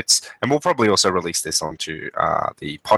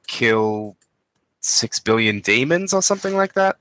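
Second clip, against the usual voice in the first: ordinary talk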